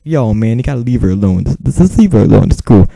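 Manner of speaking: very loud imitation of a meddling boyfriend; bad-accented boyfriend. Imitating her boyfriend butting in on the call, loud bad accent. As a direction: blast it out in a mock bad accent. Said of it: "he talked in some really bad accent".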